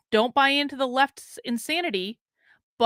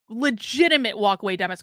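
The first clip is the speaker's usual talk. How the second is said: ordinary talk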